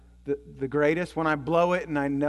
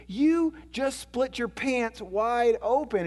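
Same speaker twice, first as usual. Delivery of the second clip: high, slow, drawn-out teasing; retold amusement, stretched out and high; mock amused exclamation; 6 semitones higher, much slower than usual. Husband teasing his wife over split pants, imitated amusement. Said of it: "he starts laughing".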